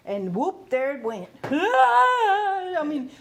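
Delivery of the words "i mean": loud high laugh into I mean. Real, not acted. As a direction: burst out laughing. Laughs at the memory.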